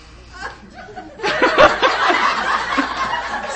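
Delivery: very high breathy laughter. Real, not acted. Laughing before telling the naked massage, a high laugh.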